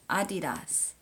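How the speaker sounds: Adidas as "AH-dee-das", tried out; repeating the pronunciation. Shown as a demonstration. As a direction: echo Adidas with an open first A. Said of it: "Say it".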